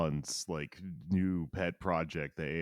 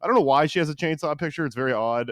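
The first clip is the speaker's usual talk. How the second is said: incredulous; 10 semitones higher, 10 dB louder than usual. Real, not acted. Incredulous outburst.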